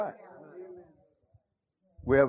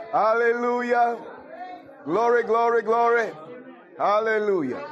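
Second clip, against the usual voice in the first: shouted praise; 10 semitones higher, 3 dB louder than usual. Real, not acted. Preacher rousing the congregation, shouted praise. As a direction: lift the glory shout up.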